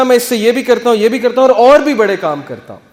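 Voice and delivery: thrilled to be asked, rushing to tell; excited Musa. Retold or imitated. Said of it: "بڑا excited ہو گئے". (پرجوش، اونچی آواز میں)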